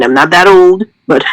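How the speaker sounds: normal talking voice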